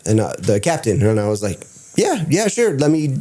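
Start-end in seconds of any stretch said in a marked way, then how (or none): 2.0-3.2 eager yes. Retold or imitated. Invited to meet the pilot.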